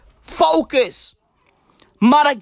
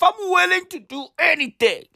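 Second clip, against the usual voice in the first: ordinary talk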